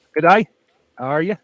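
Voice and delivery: a quick Australian "good day", loud and bright; Aussie accent. Shown as a demonstration. Viewers get a short Australian "good day" greeting. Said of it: "That's all I can say with an Aussie accent".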